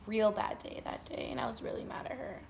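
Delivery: soft unvoiced whisper; whispered aside. Real, not acted. Speaker confides to viewers she was mad, soft whisper. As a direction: confide it under your breath. Quiet confession at the end.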